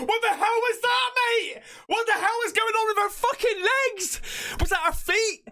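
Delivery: real panicked shouting; horrified shouting. Real, not acted. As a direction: yell at the screen, freaked out. Horrified by daughter clip.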